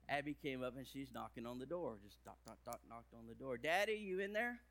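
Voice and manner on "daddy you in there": put-on little girl calling. Daughter knocking on the door.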